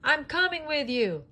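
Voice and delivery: high, loud, eager voice; eager call. A narrator's reading. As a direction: call it out as you run after him. Woody calls after Buzz.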